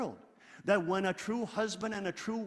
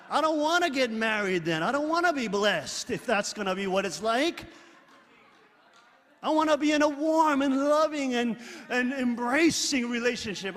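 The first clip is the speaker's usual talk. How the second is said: impassioned plea; 5 semitones higher, 6 dB louder than usual. Real, not acted. Pleading for loving marriages.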